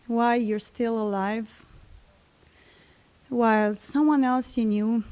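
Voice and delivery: plain reading voice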